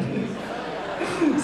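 light embarrassed laugh; short laugh. Real, not acted. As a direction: laugh it off sheepishly. Apologizes to dad, laughs.

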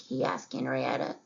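usual speaking voice

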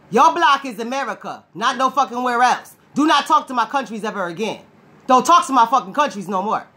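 ordinary talk, nothing marked

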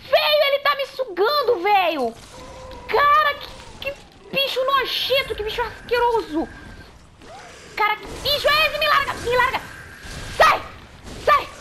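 high, loud disgusted panic; panicked shouting. Real, not acted. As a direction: shriek in horror and disgust. Creature sucking her, panicked.

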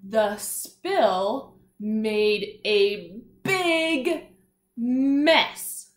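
pointing-along reading pace, mess punched; slow read-along. Shown as a demonstration. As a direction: lead the class through it word by word. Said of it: "Let's read it all together".

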